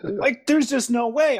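high, slow, wondering; high-pitched astonishment, drawn out slowly; amazed disbelief. Real, not acted. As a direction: still stunned as you tell it. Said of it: "I'm getting goosebumps telling you".

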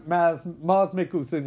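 ordinary delivery

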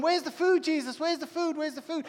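lightly acted disciples, nagging for food; pestering disciples. Disciples pestering Jesus for food, lightly acted nagging. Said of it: "Disciples are going".

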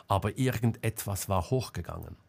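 usual speaking voice

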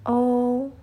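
"o" held long, high and loud; pronunciation demo. Shown as a demonstration. Teacher asks students to follow along, sustained vowel demo. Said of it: "cara pengucapannya".

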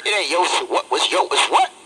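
squeaky, rising in disbelief; incredulous. Real, not acted. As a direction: voice shoots way up in disbelief.

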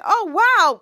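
loud, high wow of amazement; high, excited reaction; amazed. Acted. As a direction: exclaim it, thoroughly impressed. Character amazed by the gift.

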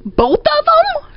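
very high, stretched retold disbelief; incredulous. Storyteller hearing both were left behind, incredulous. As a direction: squeal it slowly in mock shock. Shocked at abandoned passengers.

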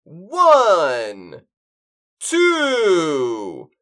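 loud, long-drawn test calls; showy sound check. Acted. Man testing sound while co-host waits, showy. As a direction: stretch each word, swooping in pitch. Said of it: "capture the full range of the complexity of my voice".

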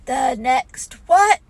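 slow, loud, baffled question; ponderous bewildered bear; puzzled bear. Acted. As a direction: ask it slowly, not understanding. Slow-witted Pooh is puzzled.

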